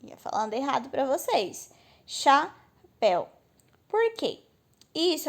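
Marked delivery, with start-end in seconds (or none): 2.0-3.3 "Chapéu" split slowly into cha and péu; slow cha, pause, péu for "Chapéu"; two syllables. Shown as a demonstration. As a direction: say "Chapéu" in two separate beats. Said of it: "O el, ele não separa".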